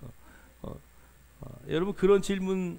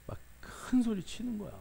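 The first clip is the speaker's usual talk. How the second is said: whispered aside; 4 semitones higher, 6 dB quieter than usual. Real, not acted. Aside about Peter's outburst.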